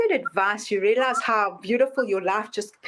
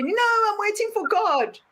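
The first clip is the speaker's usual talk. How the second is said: stubborn refusal; 11 semitones higher than usual. Retold or imitated. Man refusing helicopter rescue, high and stubborn. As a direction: refuse again, dig in.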